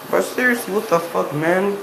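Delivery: loud, high what the fuck, man; exasperated outburst. Real, not acted. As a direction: let it burst out, genuinely baffled. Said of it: "I was in the bathroom during this whole fucking time".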